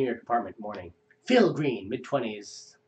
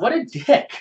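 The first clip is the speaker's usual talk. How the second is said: indignant driver; 12 semitones higher, 8 dB louder than usual. Acted. Driver insulted.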